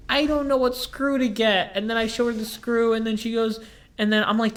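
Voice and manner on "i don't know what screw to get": put-on high old lady voice; a puzzled old lady, pitched well up; old lady customer. Old lady unsure which screw to get, high voice.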